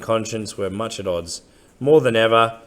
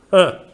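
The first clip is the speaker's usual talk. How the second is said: pleased huh; 11 semitones higher, 9 dB louder than usual. Acted. Smith reacting with approval.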